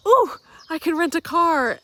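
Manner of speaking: high, loud burst of inspiration; light up with a sudden solution; excited idea. Retold or imitated. Acts out her sudden bright idea.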